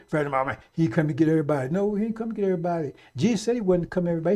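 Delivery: ordinary talk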